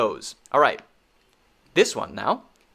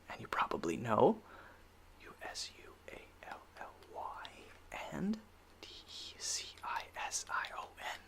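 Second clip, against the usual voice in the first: hushed, voiceless whisper; barely audible whispered aside; 3 semitones lower, 18 dB quieter than usual. Real, not acted. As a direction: spell it out in a whisper. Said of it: "Why am I whispering?".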